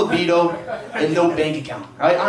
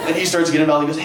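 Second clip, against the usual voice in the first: normal talking voice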